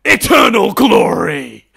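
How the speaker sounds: slow, loud, high shout; hammed-up dramatic proclamation. Host mocking Dumbledore's speech, hammed-up shout. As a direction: boom it out like a showman headmaster. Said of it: "starting a speech by going".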